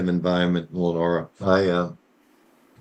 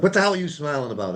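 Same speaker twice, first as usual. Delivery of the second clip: higher, quick indignant demand; lightly acted outrage, pitched up; mock indignation; 7 semitones higher, faster than usual. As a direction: imitate an exasperated wife demanding an answer. Retells his wife's angry question.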